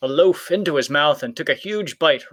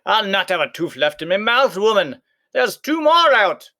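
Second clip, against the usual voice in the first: hollering; 7 semitones higher, 5 dB louder than usual. A narrator's reading. Giant losing teeth to Oonagh, loud hollering. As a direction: holler in pain to the woman. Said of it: "hollered even louder".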